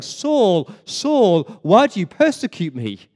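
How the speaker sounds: voice from heaven. Retold or imitated. Preacher quotes heaven confronting Saul on the road, loud calling voice from heaven. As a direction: call out as a voice from heaven. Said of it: "the voice from heaven saying".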